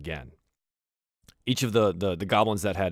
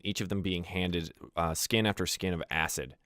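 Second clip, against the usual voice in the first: normal talking voice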